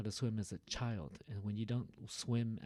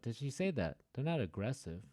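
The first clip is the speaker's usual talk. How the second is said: normal talking voice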